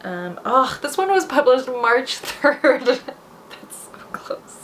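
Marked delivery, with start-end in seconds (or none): 2.9-4.6 低声自语，几乎无声，气声说出; breathy murmur under the breath, barely voiced; whisper to self. Real, not acted. Muttering at the search result.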